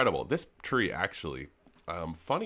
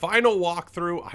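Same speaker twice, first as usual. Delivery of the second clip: louder and higher on final; excited announcement; 8 semitones higher, 8 dB louder, slower than usual. Real, not acted. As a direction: hype up the final walkthrough. Announcing the big event.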